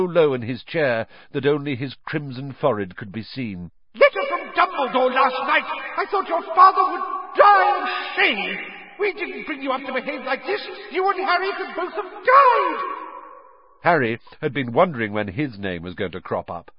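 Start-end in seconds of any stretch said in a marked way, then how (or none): 4.0-12.8 a mother's howler letter roaring at her son; furious mother yelling. A narrator's reading. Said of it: "Missus Weasley's yells".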